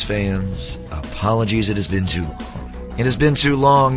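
usual speaking voice

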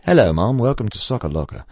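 small child's English accent, imitated. Retelling a three-year-old greeting mom, in an imitated English accent. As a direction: put on a passable English accent. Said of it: "pretty passable English accent".

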